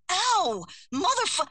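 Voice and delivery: sharp loud high cry of pain, cut off; pained yelp. Acted. Narrator as Addie yelping at the cat's tooth, loud sudden pain. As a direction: yelp as a tooth scrapes her, then bite off the curse. Cat tooth scrapes her skin.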